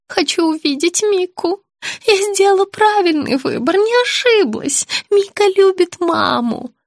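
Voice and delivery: put-on high, slow, childlike pleading; confused old woman. A narrator's reading. Sick woman begging to see her child, confused high voice. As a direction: plead slowly in a high little voice.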